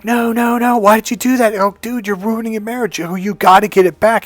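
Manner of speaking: high-pitched, urgent pleading; retold alarm, begging him to reconsider; dismayed protest. Man warns buddy he's ruining his marriage, dismayed protest. Urging friend to undo quitting.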